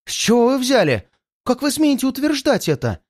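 high-pitched outraged exclamation; indignant cry. A narrator's reading. Accused woman turns on the captive detective, crying out in indignation. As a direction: cry it out in sharp protest, pitched well up. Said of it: "закричала она".

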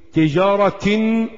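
تجارة read slowly as tijaratin; slow demo of تجارة ending in -tin; tanween with kasratayn. Shown as a demonstration. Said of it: "كسرتين".

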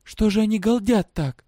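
put-on high-pitched girl thinking to herself; girl's thought. A narrator's reading. Girl thinking over the noise of prisoners, high-voiced. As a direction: voice her annoyed thought, high. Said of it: "подумала она".

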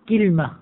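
كلمة said "KIL-ma", i after the k; two syllables, كلمة as "kilma"; kilma form. Shown as a demonstration. Teacher gives class the second dialect form, pronounced kilma. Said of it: "على وزن سدرة".

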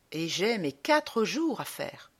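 usual speaking voice

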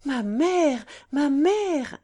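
high and loud, pleading with force; fervent, emotional outcry; passionate cry. Acted. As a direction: lift her mother up with urgent love. Daughter embraces her kneeling mother.

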